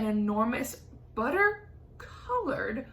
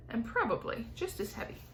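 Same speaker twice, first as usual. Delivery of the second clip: ordinary delivery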